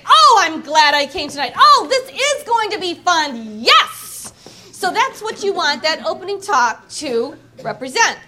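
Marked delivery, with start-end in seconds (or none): 0.0-4.3 loud, thrilled cheering; delighted party guest whooping; louder and louder until a high Yes; excited guest. Retold or imitated. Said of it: "You want people to say".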